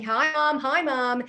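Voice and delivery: loud high call of Hi Mom; retold shouting into phone. Husband greets his mother on the phone, loud high calling. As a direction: call out to a hard-of-hearing mom.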